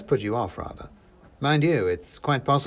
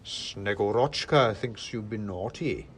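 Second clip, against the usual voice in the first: slow, weighty disapproval; suspicious big man passing judgement; Father Christmas; slower than usual. A narrator's reading. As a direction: draw it out, darkly reproving. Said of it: "said Father Christmas".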